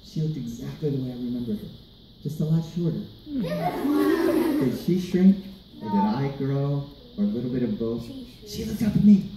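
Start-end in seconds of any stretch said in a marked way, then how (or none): none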